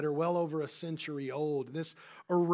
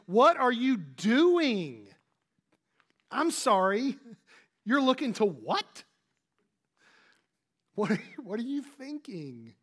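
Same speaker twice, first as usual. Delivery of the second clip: exasperated question; 4 semitones higher, 4 dB louder than usual. Retold or imitated. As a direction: ask like you can't believe what you're seeing. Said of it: "It's almost as if he is saying".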